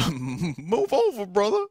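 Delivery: high, slow, laughing put-on voice; high laughing character voice. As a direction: squeak it out through laughter. Reads fan art caption, laughing.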